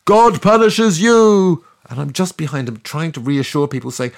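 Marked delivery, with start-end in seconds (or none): 0.1-1.6 slow, much higher, louder fanatical scream; screaming prophet. Retold or imitated. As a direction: bellow doom at the crowd. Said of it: "He screams in the street".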